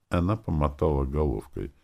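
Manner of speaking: ordinary delivery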